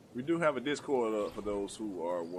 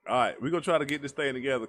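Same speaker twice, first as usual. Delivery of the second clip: pumped up; 5 semitones higher, 7 dB louder, faster than usual. Real, not acted. Rallying himself mid-round.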